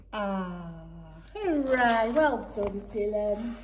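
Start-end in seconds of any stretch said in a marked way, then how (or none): none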